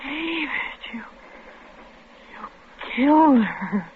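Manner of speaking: hushed, horrified whisper; shocked whisper. Acted. Wife whispers in horror to her husband. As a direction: breathe it in disbelief. Wife stunned after killing.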